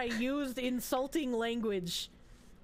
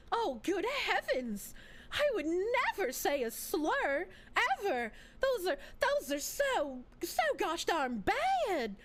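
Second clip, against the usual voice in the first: slow, high, swooping Southern gasp of horror; hammed-up Southern belle outrage; pearl-clutching Southern belle; 7 semitones higher than usual. Streamer playing a scandalised Southern belle, drawn-out accent. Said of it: "that's the accent I think of".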